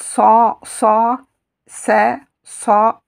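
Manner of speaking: "ça" with a dark, heavy emphatic S and open A, punched loud. Shown as a demonstration. Teacher stressing the Sad syllable so francophones hear it, loud. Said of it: "difficile pour les francophones de voir la différence".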